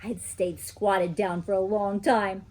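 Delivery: usual speaking voice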